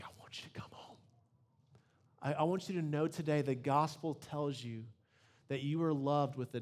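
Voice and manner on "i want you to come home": put-on whispered plea to a gone child; a parent whispering for a child to return; longing whisper. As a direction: breathe it like a parent's ache. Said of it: "you're just like".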